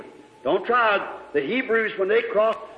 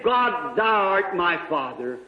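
retold prayer; 5 semitones higher, slower than usual. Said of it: "I was standing with my hands up saying".

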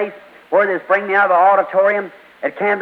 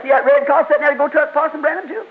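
lightly voiced wife; 8 semitones higher than usual. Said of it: "his wife said".

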